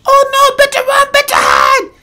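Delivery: very high, loud falsetto shrieking; mock panicked enemy. Enemies spotting the player coming, mock high-pitched panic. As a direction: squeal it in a hammed-up falsetto panic.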